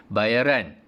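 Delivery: "Bayaran" with pitch dropping at the end; falling tone. Shown as a demonstration. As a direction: drop the voice on "Bayaran". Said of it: "cikgu jatuhkan bunyi tu". (nada menurun pada "Bayaran")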